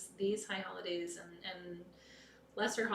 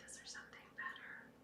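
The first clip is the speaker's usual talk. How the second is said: soft, unvoiced whisper; 12 dB quieter than usual. Real, not acted. Closing the chant with a hushed whisper to the circle. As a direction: ask it like a secret.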